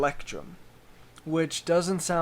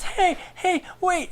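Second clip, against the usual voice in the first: high, urgent gnome voice, much higher; gnome calling out; 3 dB louder than usual. Retold or imitated. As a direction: call after them in a squeaky little voice. Gnome rushing out calling after them.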